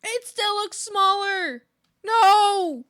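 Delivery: loud, high wail of protest; frustrated outburst; exasperated. Real, not acted. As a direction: cry out in frustration at failing. Frustrated at repeated failure.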